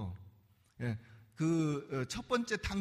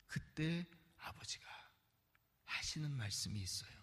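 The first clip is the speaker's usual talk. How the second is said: hushed whisper; 4 semitones lower, 10 dB quieter than usual. Real, not acted. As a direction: lower the voice to a secret hush. Hushed lead-in to verse.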